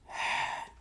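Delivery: long, soft, voiceless weary sigh; a tired father's heavy sigh. Retold or imitated. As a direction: let out a heavy breath of defeat. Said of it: "He sighs kinda heavy".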